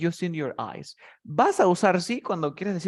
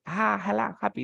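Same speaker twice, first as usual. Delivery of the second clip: higher-pitched, playful mock cheer; mock happy cry; 6 semitones higher than usual. Teacher mimicking a happy baby's cry, mock cheerful. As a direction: act out a happy little cry, not an angry one. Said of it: "no es que está que grita normal".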